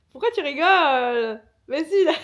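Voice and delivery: loud, high-pitched whiny complaint; indignant protest. Real, not acted. Hurt woman to her laughing partner, loud indignant protest. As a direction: protest loudly at being laughed at.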